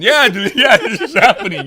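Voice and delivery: high, loud and excited; hyped up. Retold or imitated. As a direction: pump yourself up, half laughing. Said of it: "So I'm freaking".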